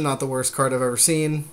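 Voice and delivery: normal talking voice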